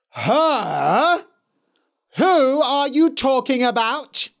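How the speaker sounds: high, loud indignant shout; outraged queen. A narrator's reading. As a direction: demand it, outraged.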